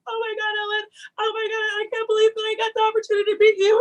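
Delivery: high, fast gushing fan; theatrical starstruck. Acted. Pretending the producer is Ellen, high fast gushing. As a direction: gush breathlessly over a celebrity. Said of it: "bringing the theatrics out".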